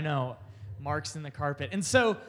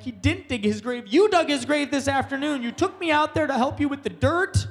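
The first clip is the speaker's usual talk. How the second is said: put-on indignant kid; 8 semitones higher, 6 dB louder than usual. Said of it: "And I'm like".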